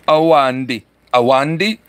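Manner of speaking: Awandi with an n before the d; trying out pronunciation. Shown as a demonstration. Streamer checking a viewer's name, carefully sounding out Awandi. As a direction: say "Awandi" carefully, then as a question. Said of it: "Am I saying it right now?".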